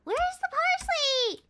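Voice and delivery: high-pitched plaintive call; exasperated searching. Real, not acted. Player still hunting for parsley, exasperated. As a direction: wail it high, dropping at the end.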